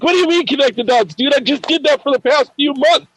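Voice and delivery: high, fast whiny protest at the flower; mock indignation. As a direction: complain in a squeaky put-upon voice. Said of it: "I'm like".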